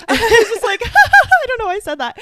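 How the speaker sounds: high, loud, laughing through the words; giggling helplessly at her own blunder. Real, not acted. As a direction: squeal it out while cracking up. Laughing while retelling embarrassment.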